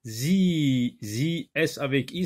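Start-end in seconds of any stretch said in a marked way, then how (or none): 0.0-1.0 ZI held long on one beat; syllabe allongée. Shown as a demonstration. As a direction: stretch out the vowel of ZI. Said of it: "ça se lit zi". (« zi » longuement tenu)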